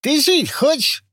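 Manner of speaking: high, slow lisping menace. Acted. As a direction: lisp it, slushy sh for s. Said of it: "Шепелявый голос".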